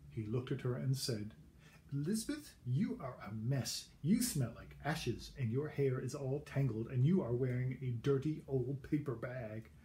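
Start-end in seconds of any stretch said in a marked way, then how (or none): 1.9-9.7 snooty prince. Acted. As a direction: look down your nose at her. Snooty prince scolding Elizabeth.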